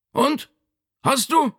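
very high, reproachful father; accusing question from the sickbed; Vito. A narrator's reading. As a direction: challenge the son in a strained high voice. Injured father reproaches his son.

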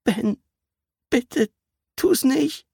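high, desperate begging; pleading. Acted. Captive pleads with Ben not to do it, high. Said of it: "schaute den Kleinen flehend an".

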